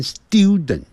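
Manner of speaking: British "student" as "styu-dent"; "student" in British style, with a y-glide. Shown as a demonstration. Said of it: "他是念student".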